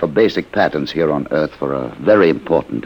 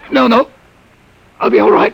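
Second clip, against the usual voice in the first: shaken; 5 dB louder than usual. Acted. Shaken man reassuring after shock.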